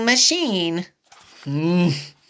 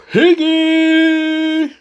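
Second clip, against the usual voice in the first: loud call, pitched up; 7 semitones higher, 5 dB louder than usual. Acted. Gerald calling out loud to Piggy. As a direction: call her across the room.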